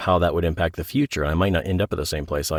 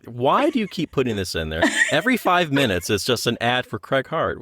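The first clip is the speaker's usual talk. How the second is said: exasperated editor; 6 semitones higher than usual. Pretend. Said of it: "And she's like".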